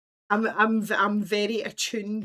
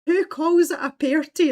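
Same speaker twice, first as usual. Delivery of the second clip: incredulous; 8 semitones higher, 9 dB louder than usual. Retold or imitated. Incredulous retold reaction.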